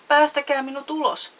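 high, loud call to be let out; storybook puppet crying out for rescue; pleading shout. A narrator's reading. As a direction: shout from inside a cage. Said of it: "huusi Pinokkio häkistä".

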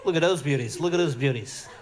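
low, conspiratorial Aussie lad; mock Aussie hunk. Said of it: "You go".

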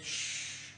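sharp shushing sound; unvoiced hush; hushing shh. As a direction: shush your husband with a long "shh". Wife hushing her husband.

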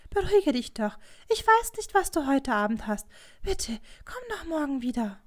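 high, almost whimpering plea; begging, close to a whine; pleading. A narrator's reading. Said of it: "mit flehentlichem, fast winselndem Ton".

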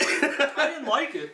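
slow, high, giggling admission; laughing. Real, not acted. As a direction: laugh through the words. Admits mistake, laughing.

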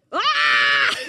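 high loud cry of lament; anguished wail. Shown as a demonstration. Preacher showing what the lamentist does, high wailing cry. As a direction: wail up to God. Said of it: "You know what the lamentist does".

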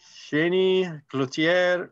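slow, careful attempt at a French accent; terrible French accent. Real, not acted. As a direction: read the name in clumsy French. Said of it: "That was a terrible French accent".

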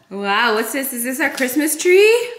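usual speaking voice

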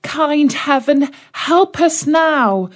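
put-on a touch of a frightened girl's plea; crying out. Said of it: "she cried".

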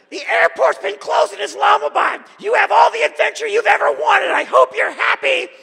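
high screeching furious boss voice; mock hysterical boss shriek. Boss email voiced as shrieking tirade.

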